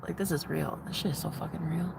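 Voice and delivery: soft, stunned hush; hushed realization. Retold or imitated. To viewers realizing it's real, soft stunned murmur. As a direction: murmur it as it sinks in. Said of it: "in that zone of, like".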